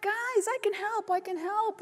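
high, small, soft little spider voice; tiny grandmother spider. Acted. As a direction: squeak it eagerly like a tiny old spider. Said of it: "Grandmother Spider's going".

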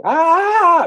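sustained high, loud scream; turtle's scream. Acted. Turtle still horrified by the death ray, screaming. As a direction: keep screaming on one long high note. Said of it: "the turtle is still screaming".

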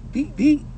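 high little beep-beep; car horn imitation. Mocking other people's horns, high little beeps. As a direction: chirp like a puny car horn. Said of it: "y'all horn sound like that song".

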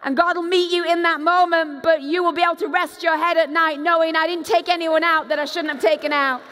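usual speaking voice

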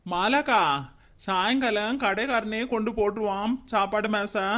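ordinary delivery

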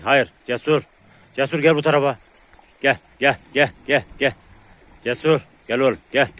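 loud call to Cesur. Real, not acted. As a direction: call him over from across the yard. Calling the dog back.

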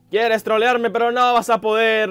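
worked-up, loud defiance; defiant outburst. Real, not acted. Taunt escalates at the enemy.

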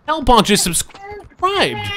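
slow, high, drawn-out sub announcement; stretched-out cheer for Hellbonk; excited announcement. Real, not acted. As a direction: hype the new subscriber like a host. Announcing a stream subscriber.

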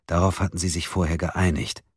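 ordinary delivery, nothing marked

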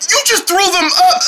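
high, appalled exclamation; disgusted disbelief. Acted. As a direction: react aghast in a high voice. Reacts to spat grapes.

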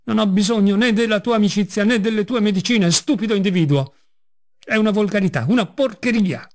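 high, furious insults hurled out; raging shout. A narrator's reading. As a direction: lose all control and yell. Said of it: "continuò a gridare".

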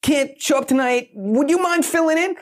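high, eager asking voice; asking a stranger. Retold or imitated. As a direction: pitch way up, eager and pleading. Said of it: "somebody goes".

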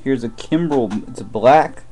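ordinary talk, nothing marked

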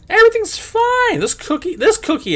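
very high put-on reassurance; nervous mock "everything's fine"; squeaky forced cheer. Forced denial after zoning out.

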